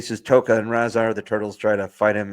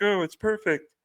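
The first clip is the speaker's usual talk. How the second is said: delighted turtles; 11 semitones higher than usual. Retold or imitated. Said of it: "And they're like".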